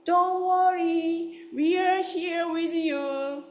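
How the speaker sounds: high, slow, soothing goose voice; geese reassuring. Retold or imitated. Flock calls down to the goose left behind, high and slow. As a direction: gently reassure the lost one from above, high and slow. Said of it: "as if to say".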